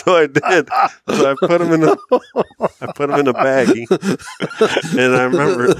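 words bubbling over with laughter; laughing. Real, not acted. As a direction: laugh through the telling. Laughing while telling the prank.

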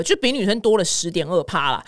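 normal talking voice

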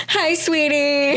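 high, slow, lovey-dovey greeting; sweet boyfriend. Acted. Boyfriend arriving with roses, sweet. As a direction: sing it out sweetly to a date. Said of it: "and he's just like".

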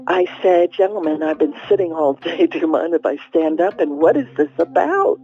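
ordinary delivery, nothing marked

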